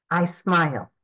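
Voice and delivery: ordinary talk, nothing marked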